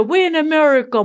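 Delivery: fast, high, loud mock protest with we're stressed; imitating the boys insisting; kids' loud complaint. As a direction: rattle off we're in America in exasperation. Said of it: "my boys will always say".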